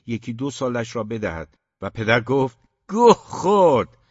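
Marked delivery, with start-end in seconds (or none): 2.9-4.0 loud, high, slow furious outburst; furious curse. A narrator's reading. The father cursing his brother, furious shout. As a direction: spit the curse out in rage.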